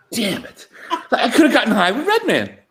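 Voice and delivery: laughing while grumbling; real chuckle of regret; laughing through it. Real, not acted. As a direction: let the laugh break through the words. Laughing at his own regret.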